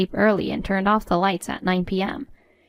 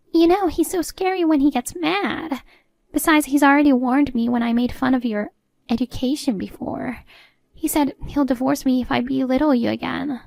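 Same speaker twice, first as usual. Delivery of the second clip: higher-pitched, worried mother-in-law voice; fearful mother-in-law; 5 semitones higher than usual. Acted. Mother-in-law dreads her husband's threat of divorce, worried and high. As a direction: fret aloud, pitched up.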